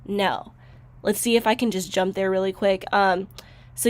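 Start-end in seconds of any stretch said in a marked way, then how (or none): none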